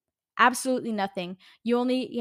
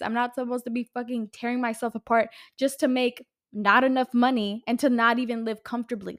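ordinary delivery

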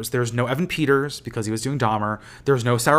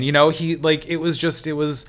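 normal talking voice

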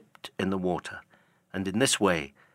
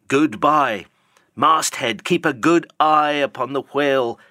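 louder, higher call up the mast; calling aloft; 4 semitones higher, 5 dB louder than usual. Acted. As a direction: hail the lookout from the boat, loud. Ahab calls up to masthead.

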